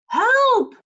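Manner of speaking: high, loud cry for help; faraway shout. A narrator's reading. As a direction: call out from far away. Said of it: "shouted a faraway voice".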